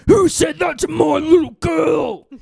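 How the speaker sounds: slow, loud mock roar; angry dad. Dad overhears the insult on speakerphone, acted angry roar. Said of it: "He's angry".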